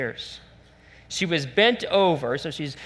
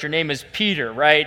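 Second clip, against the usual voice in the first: ordinary talk, nothing marked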